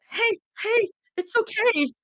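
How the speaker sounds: high, tear-choked comfort; tearful comforting. Acted. Zoe comforts crying Mira, high and choked up. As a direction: soothe her while holding back tears.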